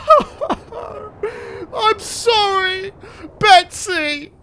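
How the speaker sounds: high wail then slow sorry; mock grief. Real, not acted. Losing Betsy, a wailing mock grief. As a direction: cry out as the pet is lost.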